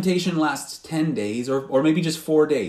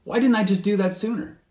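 higher, quicker exasperated "why didn't I"; frustrated self-reproach; 8 semitones higher than usual. Retold or imitated. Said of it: "go like".

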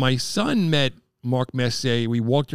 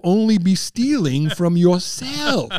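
slow put-on movie voice, a touch higher; Trading Places quote; 5 semitones higher, 5 dB louder, slower than usual. Friend recalling a movie scene, slow put-on voice. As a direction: drawl it out like the film's stiff official. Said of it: "It was like that scene from, uh, Trading Places".

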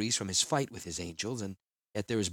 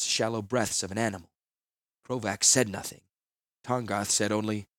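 usual speaking voice

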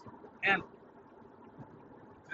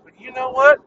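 climbs higher and louder each time; building rhetorical shout; 8 semitones higher, 12 dB louder than usual. Real, not acted. Podcaster venting at his app, building to a shout. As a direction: ramp it up into a shout.

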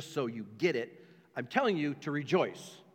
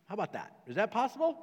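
ordinary talk, nothing marked

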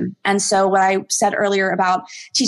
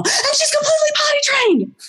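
much higher, gushing delight; thrilled owner. Retold or imitated. Owner telling the trainer the dog is trained, much higher and thrilled. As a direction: squeal the good news. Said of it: "She's like".

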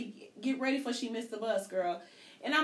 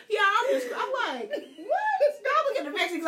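much higher and louder, laughing through words; laughing telling; 12 semitones higher, 6 dB louder than usual. Real, not acted. Mother telling the store story to friends, still laughing. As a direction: keep telling it while cracking up.

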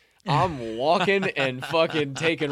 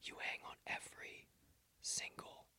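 whisper; 2 semitones lower, 18 dB quieter than usual. Shown as a demonstration. Showing friend the power of only whispering, deliberate whisper. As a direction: whisper so they hang on every word. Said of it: "if I can only whisper".

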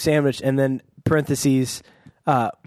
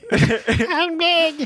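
high squeaky helium voice. Pretend. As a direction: squeak it like you inhaled helium. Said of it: "that includes helium voice".

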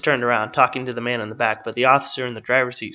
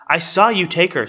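raised, higher-pitched accusing voice; heated accusation; 6 semitones higher, 6 dB louder than usual. Acted. Woman confronting the agent about the girl, heated accusation. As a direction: cut in and accuse him forcefully.

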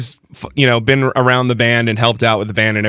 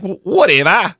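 higher, shrugging Australian "whatever"; imitating a casual Australian accent; 9 semitones higher than usual. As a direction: toss it off like a carefree Aussie, Australian accent. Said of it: "your accent is perfect".

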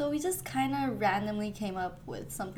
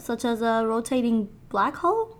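ordinary talk, nothing marked

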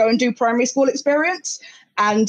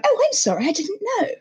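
high, softening posh little voice; posh accent; 8 semitones higher than usual. Retold or imitated. Posh new kid apologising to a local, high posh accent. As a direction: apologise as a prim posh child. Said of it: "lost my posh accent".

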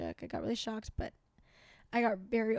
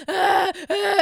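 hammed-up crying gasps, higher and louder; mock sobbing; 6 semitones higher, 11 dB louder than usual. Imitating herself crying for no reason, mock loud sobs. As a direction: fake a pair of loud sobs. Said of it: "sit here and be like".

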